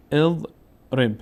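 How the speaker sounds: very slow اضرب, "ez... reb", opening on "e"; kasra on the first vowel. Shown as a demonstration. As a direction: split اضرب into two held syllables starting with "e".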